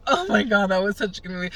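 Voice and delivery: mock tearful gush. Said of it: "in tears".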